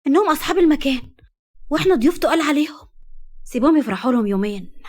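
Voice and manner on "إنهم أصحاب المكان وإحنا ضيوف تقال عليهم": higher, louder, seething resentment; indignant complaint about the bride's family; resentful. Acted. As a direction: spit out the grievance, piqued. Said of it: "هدى بغيظ".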